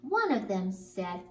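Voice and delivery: usual speaking voice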